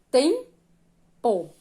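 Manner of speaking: "tempo" slowly split, stressed first syllable. Shown as a demonstration. Teacher showing pupils the highlighted syllable, "tempo" split slowly. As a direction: break "tempo" in two, landing on the first part. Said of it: "por que você acha que essa sílaba foi destacada?".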